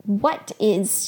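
short, demanding question; a touch of sternness; mild demand. A narrator's reading. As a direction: demand an answer, without raising the voice. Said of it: "she demanded".

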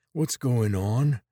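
dazed, slow, slurring words; groggy and slurred from a blow to the head; slurred speech. Acted. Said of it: "His words slurred and his eyes were unfocused".

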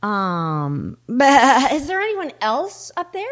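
hesitant, then loud bleat and high call; hesitate, bleat, call up for someone else; doubtful bleating sheep. Acted. Sheep seeks another helper.

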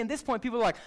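ordinary delivery

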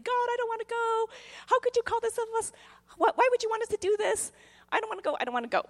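put-on high, whining childish protest; hammed-up temper tantrum, pleading with God; whiny tantrum. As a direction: whine like a kid who won't budge. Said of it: "throwing my little spiritual temper tantrum".

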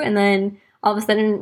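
usual speaking voice

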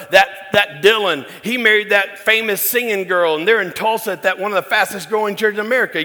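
high, loud, gushing uncle voice; bragging uncle. Retold or imitated. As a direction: crow about the family like a proud old uncle. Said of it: "So he goes".

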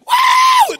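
very high, loud whooping cry; shouted wow. Retold or imitated. Preacher yelling on a plane, carried away, joyous yell. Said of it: "So I".

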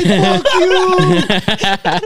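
high, loud drawn-out jeer; retold heckling yell. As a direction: re-enact yelling at a player over the rail. Retelling yelling over the rail.

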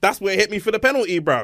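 half-hearted impression of an aggrieved player pleading his case; retold player's protest. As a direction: imitate the player moaning at the ref. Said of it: "he was saying to him".

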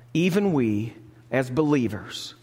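ordinary talk, nothing marked

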